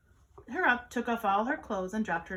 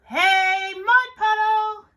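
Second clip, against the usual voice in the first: high, loud, drawn-out call; singsong shout; yelling; 10 semitones higher, 11 dB louder, much slower than usual. A narrator's reading. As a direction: holler it slowly across a yard. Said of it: "so she yelled".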